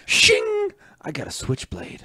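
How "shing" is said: high metallic shing; sword being drawn. Acted. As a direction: blade snapping open sound. Switchblade flicked open.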